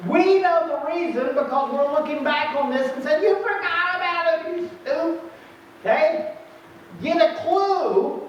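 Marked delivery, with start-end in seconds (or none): 3.2-8.2 higher, exasperated put-down; preacher mocks Gideon's forgetfulness, pitched up; mock scolding. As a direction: scold him like he's dim. Mocking Gideon in hindsight.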